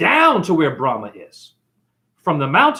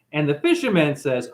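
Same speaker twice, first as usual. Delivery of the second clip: ordinary talk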